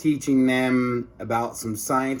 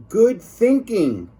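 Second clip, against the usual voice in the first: lunch lady approval; 9 semitones higher, 6 dB louder, slower than usual. Acted. Lunch lady voiced in story.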